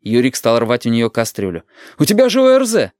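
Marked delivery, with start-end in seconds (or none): 2.0-2.9 urgent protest. A narrator's reading. Boy remonstrating with sick grandmother.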